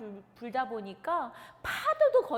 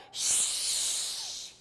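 whooshing wind. Acted.

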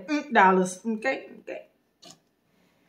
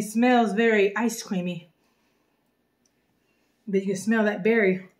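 usual speaking voice

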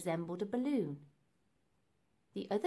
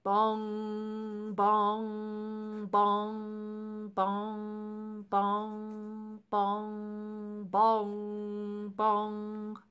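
long drawn-out bell bongs; slow, ringing clock chimes; imitated bell bongs; 3 dB louder, much slower than usual. A narrator's reading. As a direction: sing out each bong like Big Ben.

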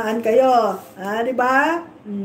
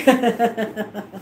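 mocking laugh; 4 semitones lower than usual. Real, not acted. Streamer jeering at a rival team, mocking laugh. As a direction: chuckle at your own jibe.

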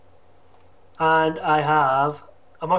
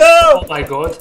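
shocked exclaim; 13 semitones higher, 15 dB louder, slower than usual. Real, not acted. Shocked reaction.

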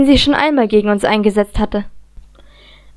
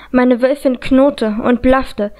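ordinary delivery, nothing marked

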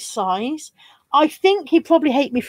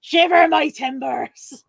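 slow, drawn-out pirate voice; 3 semitones higher, much slower than usual. Acted. Streamer playing along with pirate joke, slow pirate voice. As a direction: ham up the pirate, stretching each word. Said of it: "we both sound like pirates".

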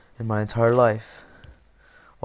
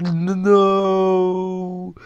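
long, high, loud wail of no; dismayed cry; 8 semitones higher, 6 dB louder than usual. Real, not acted. Fails in the game.

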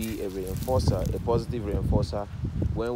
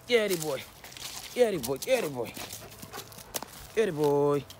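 excited dog praise; 7 semitones higher than usual. Real, not acted. Hyping the dog at play.

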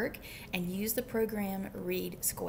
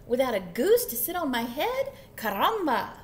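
loud, higher-pitched protest; animated storybook chicken outburst; excited chicken cry; 3 semitones higher, 7 dB louder than usual. A narrator's reading. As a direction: exclaim it like a flustered chicken. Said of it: "cried the chicken".

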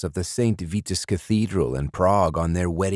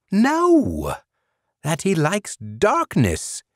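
much higher No, slow on darkness; eager correction; 14 semitones higher, much slower than usual. A narrator's reading. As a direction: correct him with excited conviction. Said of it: "Eljimi rolled his carved stone eyes".

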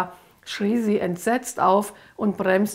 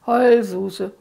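louder sing-song jeer; loud spiteful taunt; 5 dB louder than usual. Acted. Lucifer jeers at the crying girl, loud and spiteful. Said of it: "Lucifer lachte wieder gehässig".

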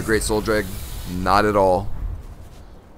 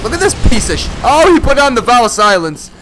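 excited outburst; 12 semitones higher, 8 dB louder than usual. Real, not acted. Reacting to opponent's item.

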